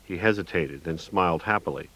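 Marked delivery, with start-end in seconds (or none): none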